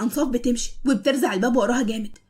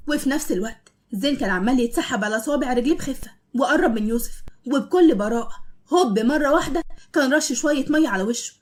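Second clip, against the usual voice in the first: ordinary delivery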